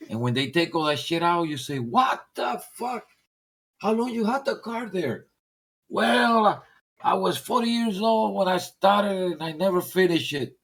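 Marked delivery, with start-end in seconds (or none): 1.8-5.3 high, slow astonished outburst; stunned collector, high and slow; shocked buyer. Retold or imitated. Shocked buyer at hoarded car. 5.9-10.6 put-on old timer voice.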